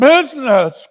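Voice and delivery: high, loud outburst from the ghost; ghost's cry. A narrator's reading. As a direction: fling the word back at him. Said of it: "cried the ghost".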